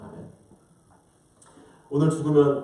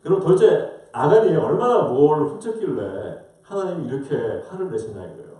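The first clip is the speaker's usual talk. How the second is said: normal talking voice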